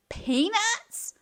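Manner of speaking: loud, high whining cry; disgruntled wail. A narrator's reading. Disgruntled little witch wailing about the peanuts. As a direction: wail it in disgust. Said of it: "wailed the disgruntled little witch".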